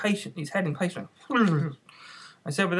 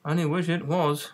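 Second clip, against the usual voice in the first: usual speaking voice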